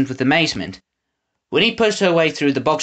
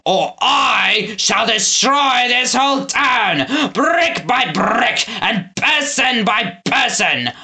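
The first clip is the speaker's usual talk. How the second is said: villain threat; 6 semitones higher, much slower than usual. A narrator's reading.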